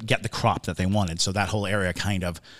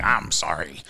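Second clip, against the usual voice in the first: lower, sheepish pirate apology; a hint of a gruff pirate mumbling sorry; 5 semitones lower than usual. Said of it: "I love your pirate voice".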